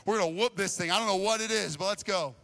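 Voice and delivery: high, loud, hyped shouting; pumped-up rallying yell; fired up. Retold or imitated. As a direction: whip the guys into a frenzy. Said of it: "all fired up".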